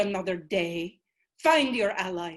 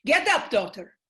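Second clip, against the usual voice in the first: loud, rousing order; commanding call; 3 semitones higher, 5 dB louder than usual. Acted. The ancestor ordering Selena off the floor, loud and rousing. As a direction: wake her from despair.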